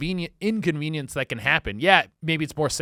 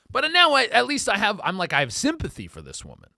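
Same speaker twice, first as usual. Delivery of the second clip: ordinary talk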